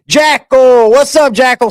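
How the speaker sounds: hearty hollered hello; roll-call shout. Real, not acted. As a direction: call the name out loudly. Calling out roll call to viewers.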